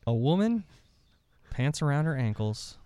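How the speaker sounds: lightly gruff storybook read; audiobook narration. A narrator's reading. Reading a made-up story aloud as the narrator, mock serious. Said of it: "I'm gonna read it in a gruff, gruff voice".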